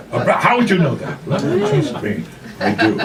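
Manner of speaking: laughing through the lines, pitch jumping up; can't hold it together, giggle through it; breaking into laughter. Real, not acted. Reader cracks up mid-dialogue.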